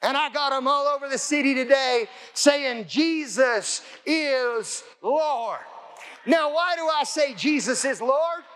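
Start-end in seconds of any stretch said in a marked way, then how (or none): 2.9-5.6 very slow, word by word; drawn-out proclamation. Retold or imitated. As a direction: declare it, stretching every word. Proclaiming the confession aloud.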